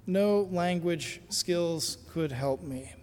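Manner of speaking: ordinary talk, nothing marked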